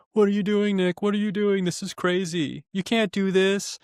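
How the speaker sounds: high-pitched imitation of panicked onlookers; mock hysterical. Housemates protesting his confrontation, hysterical mimicry. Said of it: "everyone's acting all hysterical and shit".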